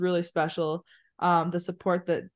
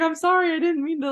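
high apologetic voice imitating her colleague; a lightly voiced, much higher sorry; apologetic quote; 11 semitones higher than usual. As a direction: pitch it up high like a flustered apology. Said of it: "she's like".

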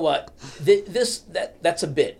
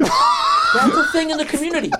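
laughing shout; 6 dB louder than usual. Real, not acted. Cracks up at his own joke.

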